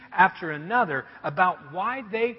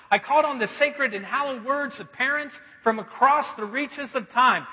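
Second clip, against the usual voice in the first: ordinary delivery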